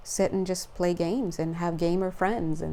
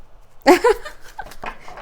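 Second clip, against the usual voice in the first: high breathless giggle; excited laugh; 12 semitones higher, 6 dB louder than usual. Real, not acted.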